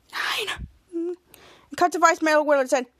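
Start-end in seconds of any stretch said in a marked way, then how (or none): 0.1-0.7 soft voiceless whisper. Retold or imitated. Ray correcting the ad's cost, whispered. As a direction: whisper it as a sly aside. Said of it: "cut to Ray and whispers".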